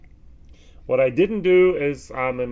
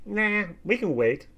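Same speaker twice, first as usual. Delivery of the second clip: higher-pitched, shrugging brush-off; unimpressed dismissal; 7 semitones higher than usual. Retold or imitated. Imagined drinkers finally tasting the new batch, unimpressed. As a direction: wave it away, unimpressed. Voices unimpressed tasters' reaction.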